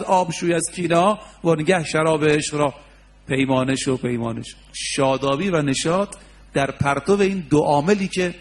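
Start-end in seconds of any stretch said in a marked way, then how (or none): 4.0-4.6 much softer, lower repeat; soft echo. Acted. Preacher echoing the verse to the crowd, softly fading. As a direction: let the repeated پیمانه شو fade away like an echo.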